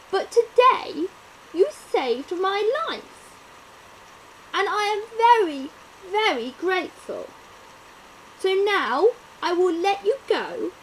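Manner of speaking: loud, high, heartfelt lion voice; grateful lion. Acted. Lion thanks the little mouse who saved him, loud and heartfelt. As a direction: thank the mouse with big feeling.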